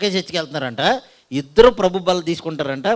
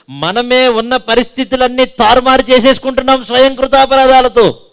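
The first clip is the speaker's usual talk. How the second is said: loud, high impassioned preaching; preaching at full force; 8 semitones higher, 10 dB louder than usual. Acted. Pastor urging the congregation to return, fervent preaching. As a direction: build to a fervent call to repentance.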